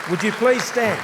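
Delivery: a ringing request over the audience. Real, not acted. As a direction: call out warmly to the hall so he stands. Inviting the honoured guest to rise.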